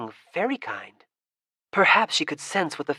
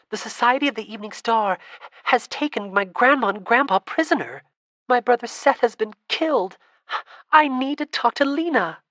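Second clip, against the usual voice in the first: building from higher and louder to a much higher near-cry; urgent, rising; 8 semitones higher, 6 dB louder than usual. Acted. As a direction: pile on the urgency until the voice cracks upward. Desperate girl pleads, building.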